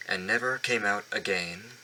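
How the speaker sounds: usual speaking voice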